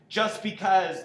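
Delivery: loud, high, over-the-top outburst; a hundred percent. Shown as a demonstration. Director showing actor what not to do, full-blast outburst. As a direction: jump straight to full intensity. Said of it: "don't jump to a hundred percent on".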